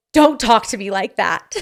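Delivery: mock-angry telling-off, changed voice; retold angry snap. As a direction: act out scolding your husband. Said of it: "he had awoken a dragon".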